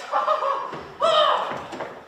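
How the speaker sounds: mocking laugh.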